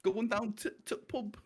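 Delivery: high mock Yorkshire; Emmerdale Yorkshire accent. Amusing friends with Emmerdale talk, mock Yorkshire accent. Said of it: "everyone from Emmerdale just sounds like that".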